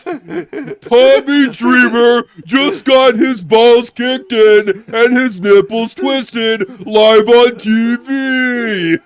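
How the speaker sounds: loud, slow gloating shout; mocking announcer shout. Don Callis roasts Tommy on air in a gloating shout. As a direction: gloat over it like a heel commentator.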